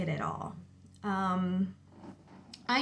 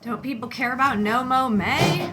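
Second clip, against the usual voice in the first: louder, exasperated rhetorical jab; annoyed aside; 4 semitones higher, 8 dB louder than usual. Real, not acted. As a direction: gripe at the noise outside. Annoyed at neighbour's lawnmower.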